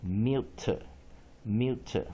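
slow, drawn-out model reading of mute; slow teacher demo. Shown as a demonstration. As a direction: draw out "mute" slowly so students hear the long U. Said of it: "I念二".

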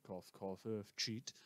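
hushed, pointed mock cough; sly aside. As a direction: slip it in quietly, knowingly. Sly mock cough naming culprit.

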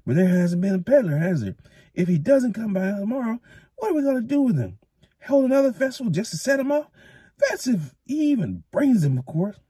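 put-on high, whining grumble of a stingy old man; complaining old thatcher. A narrator's reading. Thatcher complaining to the mayor about fireworks, whiny. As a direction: grouse about the wasted money.

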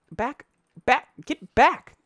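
high, loud bursts; urgent warding off. Real, not acted. Player fending off a creature in game, urgent and high. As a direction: drive the attacker away with urgent cries.

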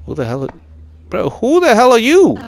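high, loud, baffled question; incredulous challenge. Real, not acted. Player meeting an unknown figure, incredulous challenge. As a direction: raise the voice sharply at a stranger.

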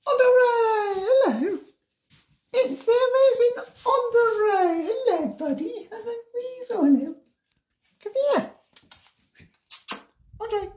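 sing-song hellos to a pet. Real, not acted. Cooing at ferret Andre.

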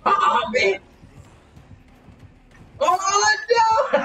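high shrieking panic; panicked scream. Real, not acted. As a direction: scream in sudden fright. Killer appears suddenly.

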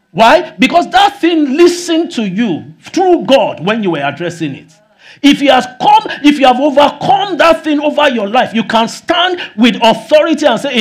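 loud, high-pitched preaching; full-force preaching. Acted. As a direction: drive it home to the congregation.

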